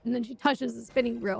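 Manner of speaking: ordinary talk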